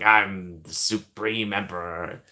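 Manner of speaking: slow, drawn-out villain voice; Palpatine impression. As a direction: declare it slowly like an evil emperor. Imitates Palpatine boasting.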